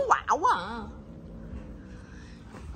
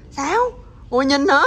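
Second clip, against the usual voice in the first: ordinary delivery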